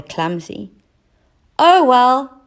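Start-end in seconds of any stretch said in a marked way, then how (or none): 1.6-2.4 high, loud, shrugging cat; brushing off the failure; resigned shrug. A narrator's reading.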